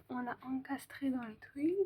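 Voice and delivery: soft voiceless whisper. Real, not acted. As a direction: whisper so nobody wakes. Whispering in quiet apartment.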